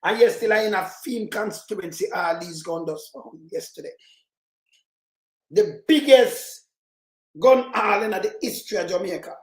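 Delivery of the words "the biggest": "biggest" drawn out slowly and punched louder; slow emphatic stress. Real, not acted. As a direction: lean hard on "biggest", slow and heavy. Stressing the record gun find.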